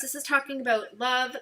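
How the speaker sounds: usual speaking voice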